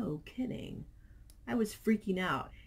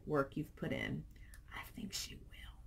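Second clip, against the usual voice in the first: whisper; 4 semitones lower, 10 dB quieter than usual. Real, not acted. Soft whisper after emotional comment.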